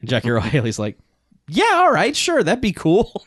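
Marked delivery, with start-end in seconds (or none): 1.5-3.2 high, drawn-out dopey yes; goofy eager agreement. Retold or imitated. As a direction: agree far too readily, as a spoof. Villain casually agreeing to plot.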